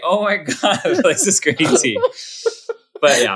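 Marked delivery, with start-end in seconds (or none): 0.0-2.0 amazed, laughing. Retold or imitated. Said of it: "And I was just like".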